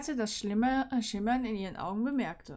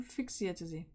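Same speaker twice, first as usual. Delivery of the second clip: ordinary delivery, nothing marked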